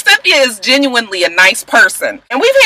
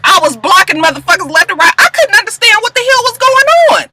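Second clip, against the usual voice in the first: exasperated rant; 7 semitones higher than usual. Real, not acted. Vlogger tells viewers about Twitter stans, exasperated high rant. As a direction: pitch jumps way up in disbelief.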